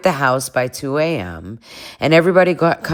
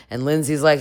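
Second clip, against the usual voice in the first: ordinary delivery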